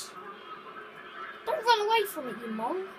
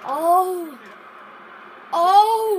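loud, higher shocked gasps of oh; 3 semitones higher, 10 dB louder than usual. Real, not acted. Boy watching a FIFA match with his friend, loud shocked oh. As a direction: react as if something just went badly wrong. Reacting to a game moment.

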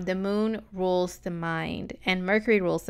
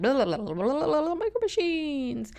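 tongue-rolling speed babble climbing much higher; rapid-fire Micro Machine Man; 7 semitones higher than usual. Retold or imitated. Imitating the fast talker in an old commercial, rapid-fire. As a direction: gabble it like a toy-car pitchman. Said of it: "talked so freaking fast, like".

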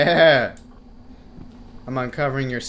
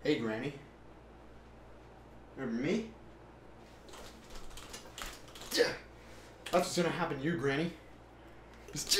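quiet taunting; 10 dB quieter than usual. Real, not acted. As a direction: taunt her under breath.

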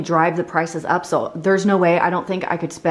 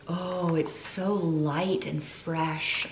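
savoring; 2 semitones lower, 8 dB quieter, much slower than usual. Real, not acted. Smelling the hand soap.

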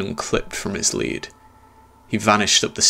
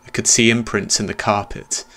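ordinary delivery

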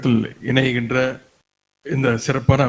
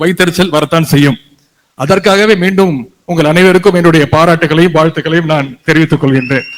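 thunderous political oratory; 3 semitones higher, 5 dB louder than usual. Acted. Rally speech builds to full force.

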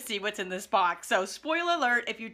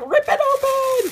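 high, loud mock demand from the audience; impatient viewers; 7 dB louder than usual. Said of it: "They're like".

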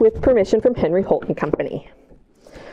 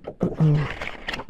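surprised gasp; 6 semitones lower, 5 dB quieter than usual. Acted. Ducklings found.

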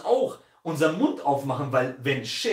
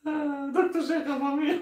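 spöttischer Titel; 11 semitones higher than usual. Real, not acted. Mocking the rival's title.

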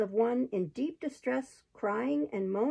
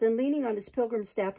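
ordinary delivery

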